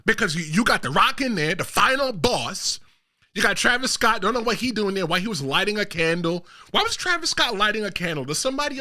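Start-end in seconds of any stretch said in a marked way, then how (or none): none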